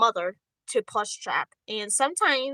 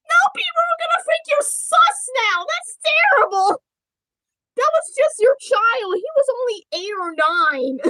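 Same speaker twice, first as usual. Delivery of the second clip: very high, loud dismay; squealed mock horror; panicked outburst; 12 semitones higher, 7 dB louder than usual. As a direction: cry out in high-pitched, hammed-up panic. Panicked regret over word choice.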